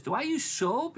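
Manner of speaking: put-on high questioning kid voice; imitated young kid. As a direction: ask up high, mimicking a child. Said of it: "he goes".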